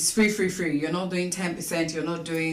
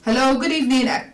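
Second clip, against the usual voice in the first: to someone hard of hearing; 7 semitones higher, 5 dB louder than usual. Real, not acted. Caller on a bad phone line.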